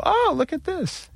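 put-on high, slow little-kid wonder; high, slow toddler voice marveling at Elmo. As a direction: coo like a small child. Imitating toddler watching Elmo.

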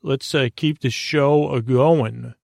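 ordinary talk

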